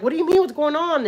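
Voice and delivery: high-pitched urgent questions; startled, voice jumping up; retold alarm. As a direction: re-enact bewildered demands on the phone. Mother questions caller in alarm.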